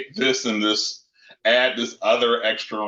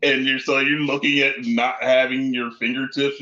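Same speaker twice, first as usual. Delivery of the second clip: normal talking voice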